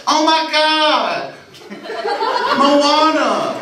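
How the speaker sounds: drawn-out, high, excited squeal. Retold or imitated. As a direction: play a thrilled older woman gushing.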